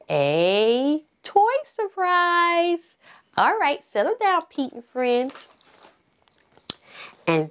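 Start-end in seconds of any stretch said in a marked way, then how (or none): 0.1-3.0 excited. Acted. Teasing the toy reveal.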